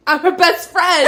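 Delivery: loud, high playful imitation; mock defiant declaration. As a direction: belt it out, mimicking a protective friend. Acting out a friend's standoff.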